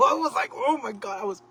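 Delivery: high, loud squealing outrage; mock outrage. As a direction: relive the fury, squealing. Retelling anger at broken shelf.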